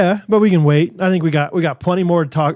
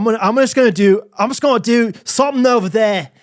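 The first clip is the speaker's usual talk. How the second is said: put-on loose British accent sliding toward Australian; improvised British-Australian accent; 2 semitones higher than usual. Co-host asks for Scottish, so improvised wobbly British-Australian accent. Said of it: "it's gonna be all over the place".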